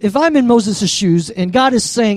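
normal talking voice

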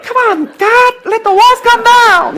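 a puny fighter squeaking at God; mock Pee Wee Herman pleading with God. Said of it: "you just look like Pee Wee Herman".

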